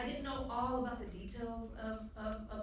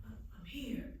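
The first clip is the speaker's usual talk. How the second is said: whisper; 3 semitones higher, 4 dB quieter than usual. Retold or imitated. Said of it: "He's like".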